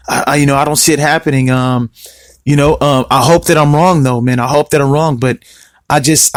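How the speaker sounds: low, laid-back, resigned-sounding musing; casual self-imitation. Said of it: "I said it in that exact tone of voice".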